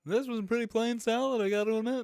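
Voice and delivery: high, whiny stand-up bit; Jerry Seinfeld. Acted. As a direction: puzzle over it like an observational comic. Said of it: "that's a Jerry Seinfeld joke".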